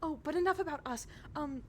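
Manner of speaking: high, bubbly noble lady; cheerful Adela. Acted. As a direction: chirp it up like an eager hostess. GM voicing Adela.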